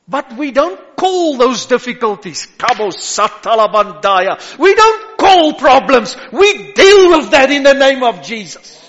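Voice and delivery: high, loud preacher's cry; preaching at full force. Acted. Pastor assuring the congregation, in full preaching cry. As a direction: lift the church with a ringing call.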